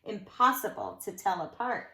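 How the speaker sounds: lilting rhymed read-aloud; sing-song storybook telling; story narration. A narrator's reading. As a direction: read it to children with bounce. Reads a rhyming picture book.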